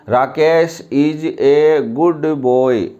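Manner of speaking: "a" read as a drawn-out "ए", very slow; mock the common reading with "a" as "ए"; wrong pronunciation demo. Shown as a demonstration. Teacher imitating how students misread the sentence, "a" as "ए". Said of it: "ऐसे पढ़ते हैं। This is absolutely wrong pronunciation".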